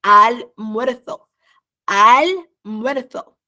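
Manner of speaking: Almuerzo drawn out slowly and loud, al-MUER-zo; teacher drilling Almuerzo slowly; slow model pronunciation. Shown as a demonstration. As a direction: stretch out Almuerzo for students to copy. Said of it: "Isso é o que a gente fala também".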